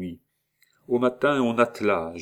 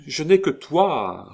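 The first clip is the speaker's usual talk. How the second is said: higher, louder, slow wounded cry; anguished protest; 8 semitones higher, 6 dB louder, slower than usual. A narrator's reading. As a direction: plead as if accused. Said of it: "répliqua la comtesse, comme si on l'eût accablée". (cri blessé, plus aigu, plus fort et lent)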